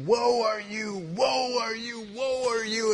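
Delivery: high, loud prophet's denunciation; hammed-up doom-crying prophet; Isaiah. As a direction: chant the accusation at the crowd. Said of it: "he's like".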